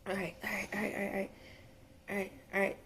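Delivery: soft, low whispery murmur; quietly steady yourself; hushed self-composing. Real, not acted. Medium composing herself between messages, hushed and low.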